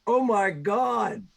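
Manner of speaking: loud, slow, high exclamation of shock; drawn-out dismay. Acted. Game master reacting to a failed dodge save, drawn-out dismay. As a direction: stretch it out in mock horror.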